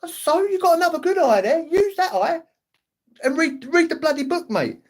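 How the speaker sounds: telling granddad to use his good eye; exasperated retort. Retold or imitated. Snapping back at his granddad.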